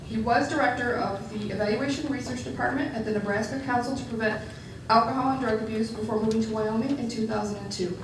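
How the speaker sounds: usual speaking voice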